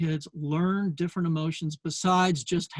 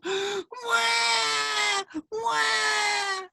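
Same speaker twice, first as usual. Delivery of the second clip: hammed-up child's boo-hoo; mock wailing. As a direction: wail like a little girl bursting into tears. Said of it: "starting to well up with tears".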